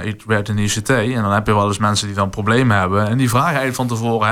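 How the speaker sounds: ordinary delivery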